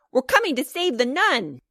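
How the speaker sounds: put-on high, eager boys' battle cry; excited shriek. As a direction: announce the rescue like thrilled kids. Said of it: "shrieked the boys".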